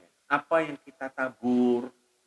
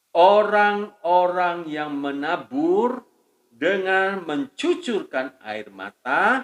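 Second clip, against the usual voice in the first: scripture reading; 4 semitones higher, 7 dB louder, slower than usual. Acted. Reads scripture slowly, louder.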